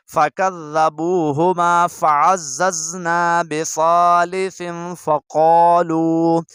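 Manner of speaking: slow, drawn-out tilawat; measured Quran reading; Quran recitation. Shown as a demonstration. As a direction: recite it slowly and clearly for students. Reciting Surah Yasin.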